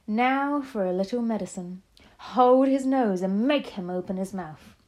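loud, emphatic villainous witch; commanding witch. A narrator's reading. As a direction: relish the threat, then give the order forcefully. Villain orders forced dose of potion.

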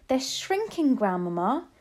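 put-on high-pitched astonished boy; amazed child. A narrator's reading. Boy tells his grandmother what he sees, amazed. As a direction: exclaim it high, in wonder.

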